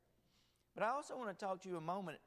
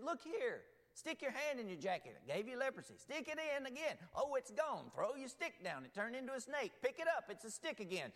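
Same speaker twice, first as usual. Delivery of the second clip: higher, lively retelling of God; lightly voiced patient Lord; the Lord speaking; 7 semitones higher than usual. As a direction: voice God showing off signs to Moses.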